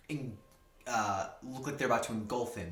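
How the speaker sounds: ordinary delivery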